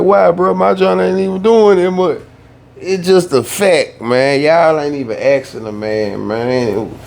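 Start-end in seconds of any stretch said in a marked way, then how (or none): 0.0-4.4 higher and louder, protesting; mock the other guy's defensive whine; a dismissive imitation; imitating the other dude. Other uploader answering him.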